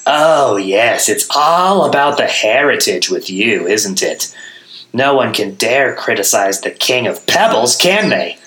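high, loud sneering sarcasm; sarcastic taunt. A narrator's reading. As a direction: needle the golem with mockery. Gaz sarcastic rant.

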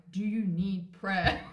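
slow, loud, overdone question; mock-exaggerated ask. Shown as a demonstration. Telling her friend how it felt in her head, hammed-up slow loud ask. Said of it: "I didn't say it like that".

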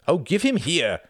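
put-on high, loud boyish demand; impatient cry. A narrator's reading. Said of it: "cried Reginald".

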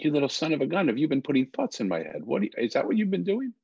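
high, loud amazed disbelief; stunned delight at his daughter; you little son of a gun, said rising in wonder; amazed outburst. Retold or imitated.

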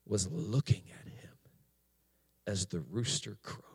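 hushed, breathy whisper at the climax; dramatic whisper. Real, not acted. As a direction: draw them in with a whisper. Dramatic moment, Jesus gazes at Peter.

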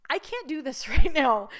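high, cracking, laughing overwhelm; high, laughing overwhelmed cry. Retold or imitated. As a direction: squeak it out, laughing in disbelief. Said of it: "I'm like".

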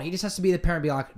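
ordinary talk, nothing marked

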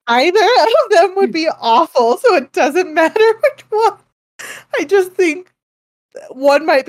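talking through high laughter; trying to speak while cracking up; words squeezed out high between laughs; laughing. Real, not acted. Laughing at the moans mix-up.

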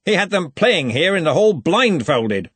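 high, loud, hearty needling; jovial with a slight menace; threatening joviality. A narrator's reading. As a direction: crow it out cheerfully, pointedly. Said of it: "with the same slightly threatening joviality".

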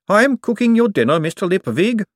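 high, stiff golem voice; golem Gladys. Acted. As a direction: speak like a dutiful clay servant.

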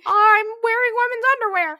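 loud, high Pinocchio squeak. Pretend. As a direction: play the little wooden boy confessing. Continuing the Pinocchio bit.

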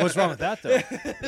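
high, loud laughing question; real laughing protest. Real, not acted. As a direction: laugh through the mock protest. Laughing retort about wife's list.

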